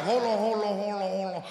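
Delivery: put-on rushed Spanish-accented shopkeeper brushing you off; Puerto Rican bodega owner voice. Store owner waving off the customer, mock accented. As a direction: talk like a busy store owner on the phone. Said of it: "He like".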